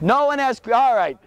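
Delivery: ordinary delivery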